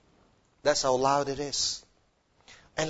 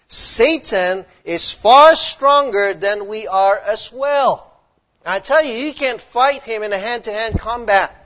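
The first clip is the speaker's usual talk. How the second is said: fiery sermon climax; preaching; 6 semitones higher, 10 dB louder than usual. Acted. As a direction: thunder it from the pulpit. Preaching at full force.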